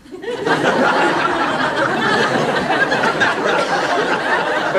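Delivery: a high, breathy giggle; light amused titter; high airy laugh. Acted. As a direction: giggle at your own little game. Laughs after counting the dogs.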